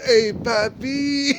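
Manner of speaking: put-on slow, coy come-on from a tipsy older woman; lightly acted drunk lady hitting on him. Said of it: "She turns around to me and goes".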